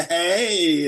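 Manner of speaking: drawn-out syrupy Southern "hey"; syrupy Southern accent. Retold or imitated. Imitating Sarita's greeting, syrupy Southern accent. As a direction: imitate a sweet Southern girl stretching the word. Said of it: "it was syrupy".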